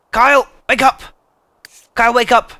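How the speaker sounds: high, loud wake-up call; calling out to wake someone. Pretend. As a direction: yell it to rouse a sleeper.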